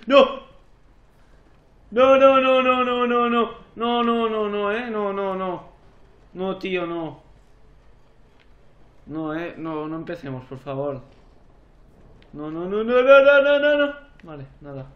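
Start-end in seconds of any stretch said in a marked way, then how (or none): 0.1-7.2 real panic, loud high string of no's easing off; panicked shouting. Real, not acted. Panic at game threat. 12.3-13.9 rising panic. Real, not acted.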